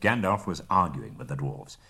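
usual speaking voice

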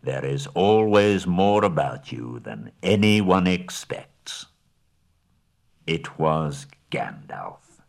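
slow, grave wizard's counsel; steady the hobbit's courage; wise old wizard. Acted. Gandalf encouraging Bilbo before the trouble ahead, slow and grave.

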